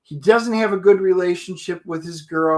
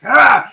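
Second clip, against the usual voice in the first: loud retold power cry; power yell; 6 semitones higher, 7 dB louder than usual. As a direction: let out a surging yell. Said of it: "he's like".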